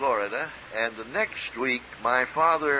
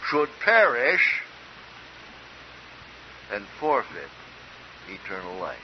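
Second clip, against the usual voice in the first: preaching. Acted. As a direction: draw each word out with pulpit force. Preaching from the pulpit.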